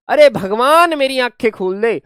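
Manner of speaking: अरे भगवान called out loud; loud, pleading cry to God. Shown as a demonstration. Acted loud prayer. (भगवान से ज़ोर से पुकार)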